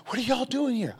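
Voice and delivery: high, amazed question; surprised. Retold or imitated. Injured lady greeting her bosses at the hospital, surprised and high. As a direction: voice the lady's astonishment up high.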